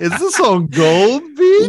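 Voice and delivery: high, loud, drawn-out mocking imitation; Joseph. Cohost mimicking Joseph's suspected setup, high and mocking. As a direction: tease with a singsong, exaggerated setup question. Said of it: "like Joseph was getting ready to".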